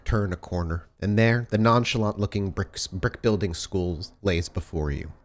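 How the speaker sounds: ordinary talk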